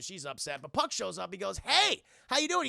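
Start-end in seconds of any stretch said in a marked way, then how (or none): 1.6-2.7 high, loud hey; acted cheeky greeting; breezy trickster greeting. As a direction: pop in with a high, loud hello. Puck popping up.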